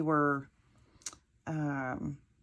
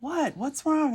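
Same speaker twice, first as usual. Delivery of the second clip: alarmed husband; 9 semitones higher, 4 dB louder than usual. Retold or imitated. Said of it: "He's like".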